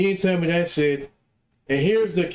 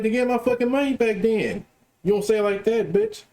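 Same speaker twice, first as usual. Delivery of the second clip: much higher, fired-up retort; heated comeback; 8 semitones higher than usual. Retold or imitated. Telling off the unprofessional seller, high indignant retort. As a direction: snap back at the seller who insulted him.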